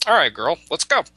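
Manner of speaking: put-on a laid-back buffalo taking the girl away; casual buffalo voice. Buffalo casually calling the chief's daughter along, rising high. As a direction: swing up high on let's go, easy and cocky.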